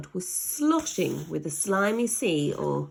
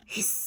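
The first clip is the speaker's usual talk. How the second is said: short cat hiss; 6 dB quieter than usual. Acted. Aunt Greta's cat, short hiss. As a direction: hiss sharply.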